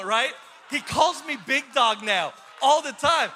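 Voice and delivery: ordinary talk, nothing marked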